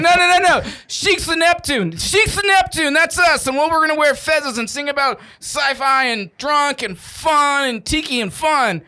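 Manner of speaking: put-on loud, high-pitched drunk proclamation; re-enacted plastered enthusiasm, half shouting; excited drunken correction. Acts out drunkenly correcting his botched pitch.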